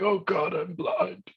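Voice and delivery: high panicked wail; panicked cry. Acted. Blinded captain to the crew, high panicked wail. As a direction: cry out in sudden terror.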